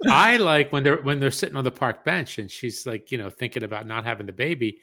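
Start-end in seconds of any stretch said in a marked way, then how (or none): none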